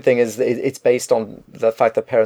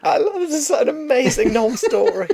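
mock delight. Mocking delight at the story.

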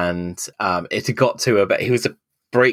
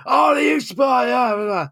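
mock shouting crowd. The pub table shouting her down, mock yell. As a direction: caricature the table yelling at her. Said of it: "all shouting at me".

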